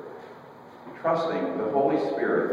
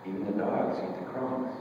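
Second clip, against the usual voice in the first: soft retort; 9 dB quieter than usual. Retold or imitated. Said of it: "she questions back to him".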